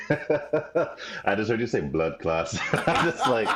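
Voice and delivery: giggling through the words; laughing. Real, not acted. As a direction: laugh while talking. Laughing at blood clots remark.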